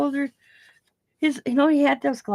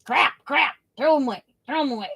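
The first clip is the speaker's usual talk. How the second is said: lightly voiced dismissive old tradesman; old German repairman; 4 semitones higher than usual. Scissors man rejecting her scissors, mock dismissal. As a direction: repeat it in quick, mock-disgusted bursts. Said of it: "he's an old German guy".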